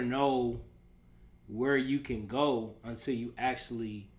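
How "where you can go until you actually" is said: ordinary talk, nothing marked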